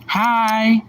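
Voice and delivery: high, sweet put-on voice; high mock-friendly voice; light side voice. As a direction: play the innocent light side.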